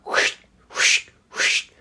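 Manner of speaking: breathy whoosh like a broom on cement; whooshing noise. Shown as a demonstration. Imitating a man brushing leaves on cement, airy whooshes. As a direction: make the brushing sound of leaves. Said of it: "the noise it was making was like this".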